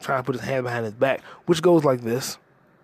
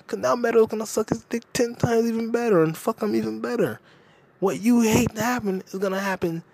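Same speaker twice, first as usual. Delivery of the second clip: high-pitched rant; 9 semitones higher than usual. Real, not acted. Telling Tony Soprano off about Meadow, high-pitched rant. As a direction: voice jumps high in disbelief.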